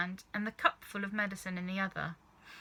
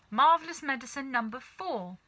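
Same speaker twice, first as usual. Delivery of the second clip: ordinary delivery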